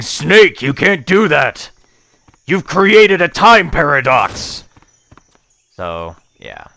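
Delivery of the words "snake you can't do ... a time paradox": loud, high mock-dramatic shout; Colonel. Colonel berating Snake over a time paradox, shouting. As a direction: yell it in alarm, stretching the last accusation. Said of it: "shouts at you".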